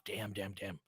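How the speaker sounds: ordinary talk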